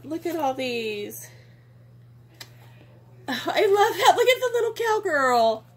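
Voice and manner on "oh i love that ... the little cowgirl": much higher, laughing delight; delighted squeal. Real, not acted. Finding a cute cowgirl in the box, squealing with delight. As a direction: gush over something adorable.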